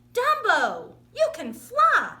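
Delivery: joyful shout. Acted. Mouse discovering elephant can fly, joyful high shout. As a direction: shout it with pure joy. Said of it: "he shouted joyfully".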